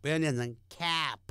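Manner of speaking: ordinary delivery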